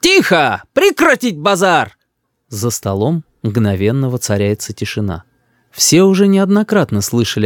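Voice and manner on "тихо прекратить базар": high, drawn-out commanding cry; little boy yelling a command; child's shouted order. A narrator's reading. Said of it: "Рис вдруг грохает по столу ... и кричит".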